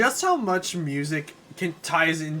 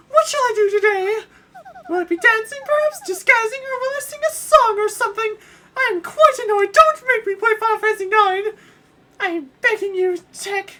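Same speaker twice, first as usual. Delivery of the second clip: much higher, loud whiny princess; high falsetto princess complaining; 10 dB louder than usual. Acted. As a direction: squeal like a spoiled princess. Voices Princess Peach.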